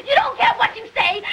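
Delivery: ordinary talk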